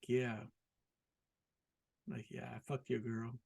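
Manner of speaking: quiet, smug and self-satisfied; a hint of a cocky guy bragging; mock smug brag. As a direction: gloat under your breath. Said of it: "like, this smug thing".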